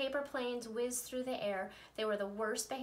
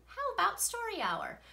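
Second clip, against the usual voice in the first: bright offer; 11 semitones higher, 5 dB louder than usual. Acted. Teacher offers story hour.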